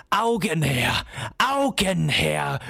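a sinister figure demanding eyes; dull booming voice. A narrator's reading. Coppelius fordert dem Kind gegenüber Augen, dröhnend. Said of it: "mit dumpfer, dröhnender Stimme".

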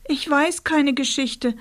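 put-on high, pitiful child voice; plaintive. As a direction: whimper it, small and helpless. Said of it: "sagte Rosa kläglich".

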